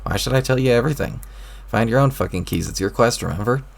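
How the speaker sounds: ordinary delivery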